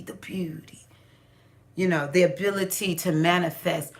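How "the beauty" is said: breathy whisper. Real, not acted. Listing Oshun's gifts.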